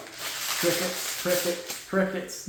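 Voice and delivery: soft unvoiced whisper of crickets. The Cardinals ignoring Dortch, whispered crickets. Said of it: "the Cardinals were like".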